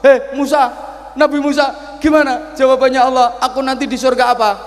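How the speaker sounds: put-on high, loud demanding old man; impatient old man. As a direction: press the prophet for an answer. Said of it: "Siapa itu?". (kakek tua mendesak dengan suara tinggi keras)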